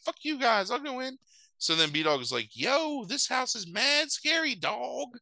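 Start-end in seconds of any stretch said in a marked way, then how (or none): 0.1-1.2 put-on high little kid voice, defiant; B-Dog. Puppy answering the dogs' taunt, high kid voice. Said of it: "he's got a little kid's voice". 2.6-5.2 put-on very high, drawn-out little kid voice; slow squeaky puppy talking street slang; B-Dog. Puppy outside the haunted house, slow high kid voice. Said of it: "he's got a little kid's voice".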